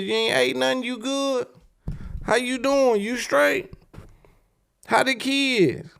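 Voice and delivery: high crooning lover voice; sweet-talking ex on the phone. Retold or imitated. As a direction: coo like a man sliding back in. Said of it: "go to hitting you back".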